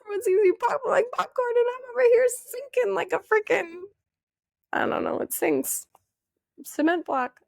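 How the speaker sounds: very high, frantic mock despair; hammed-up high panicked plea; desperate pleading. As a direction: squeal it like you're drowning in confusion. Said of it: "I kept going to people being like".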